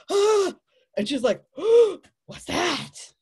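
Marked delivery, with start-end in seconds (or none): 0.1-0.6 high, loud mock gasp; startled male's gasp. Male tarantula in standoff with female, high startled gasp. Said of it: "And he's like". 1.6-3.1 high, loud, drawn-out curiosity; gasp, then slow high excited question; excited female tarantula. Acted. Female tarantula facing male at her door, excited high voice. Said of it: "And she gets excited".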